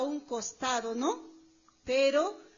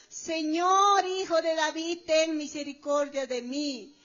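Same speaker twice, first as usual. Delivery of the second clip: raised plea, loud at the start; crying out; 4 dB louder than usual. Retold or imitated. Canaanite woman begging Jesus for mercy, crying out. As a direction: call out for mercy, then ease off. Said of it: "estaba clamando".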